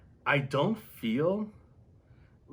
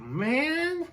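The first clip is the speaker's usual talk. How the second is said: high squeal of delight; excited; 4 dB louder than usual. Retold or imitated. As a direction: gush it up high. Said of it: "I'm like".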